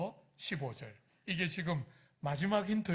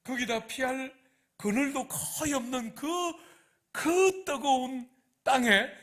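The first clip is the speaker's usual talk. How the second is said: bitter complaint; 5 semitones higher, 6 dB louder than usual. Retold or imitated. All-day laborer complaining to the owner, high and loud. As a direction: drag out the heat and the toil, getting hotter. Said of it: "이러면서".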